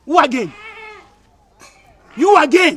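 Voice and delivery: loud, indignant confrontation; angry challenge. Acted. Jealous partner confronting an unwelcome rival, angry challenge. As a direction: challenge them sharply and loudly.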